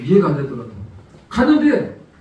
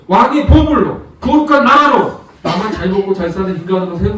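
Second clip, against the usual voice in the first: loud, rising sermon exhortation; full-force preaching; 3 semitones higher, 4 dB louder than usual. Acted. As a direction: drive the congregation with building fervor. Preaching climax.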